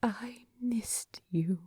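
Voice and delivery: slow, soft, breathless confession; whispered. Acted. Bilbo confessing to Thorin, whispered and slow. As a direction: breathe it out tenderly. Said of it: "a whispered".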